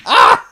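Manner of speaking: high startled cry; sharp yelp of pain. Real, not acted. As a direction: yelp as the head hits. Bumps head.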